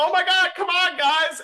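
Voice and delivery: high, loud exasperated hurry-up; acted high-pitched urging; urgent calling out. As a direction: call the group over, high-pitched and impatient. Character urges the group from car.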